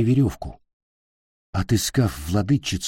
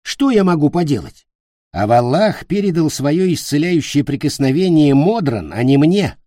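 higher, louder complaining witch; irritated witch; 6 semitones higher, 5 dB louder than usual. A narrator's reading. The witch tells Питер she cannot heal, irritated. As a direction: protest as a testy witch. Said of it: "ответила ведьма".

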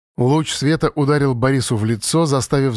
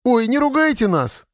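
put-on very high, girlish squeak; squeaky plea; 11 semitones higher than usual. A narrator's reading. Lera begging Fyodor not to scold, squeaky plea. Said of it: "пискнула Лера".

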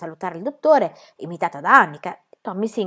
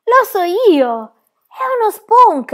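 much higher, louder, slow triumphant cry; radiant delight; 13 semitones higher, 9 dB louder, much slower than usual. A narrator's reading. Pippi discovers the beetle, beaming triumph. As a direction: announce the discovery with joy. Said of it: "si illuminò d'un sorriso radioso".

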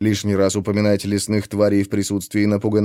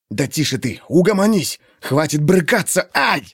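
urgent hushed scolding; whisper yelling; 5 semitones higher than usual. A narrator's reading. Brother restraining sister in hiding.

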